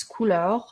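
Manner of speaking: "couleur" with French vowels and throaty final R; French pronunciation. Shown as a demonstration. Vlogger giving viewers the French word for color, French "couleur". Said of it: "closer to the French".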